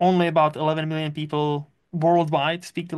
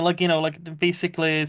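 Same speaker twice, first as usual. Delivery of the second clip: ordinary talk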